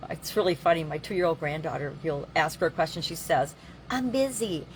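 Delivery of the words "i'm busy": put-on higher, toddler-like voice; little baby voice. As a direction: imitate a two-year-old saying it. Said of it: "in her little baby voice".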